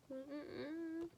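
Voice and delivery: disapproving mm-mm. Retold or imitated. Disapproving of the teacher.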